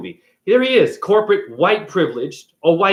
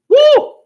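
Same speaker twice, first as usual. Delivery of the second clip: loud, high whoop effect; imitated near-miss whoosh, high and loud; high "woo"; 9 dB louder than usual. Imitates ball flying past as he ducks.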